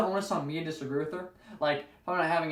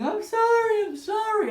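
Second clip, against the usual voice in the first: much higher, louder sobbing apology; mock bawling; 6 dB louder, slower than usual. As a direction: wail the apology like the crying cashier. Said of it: "she's bawling and saying".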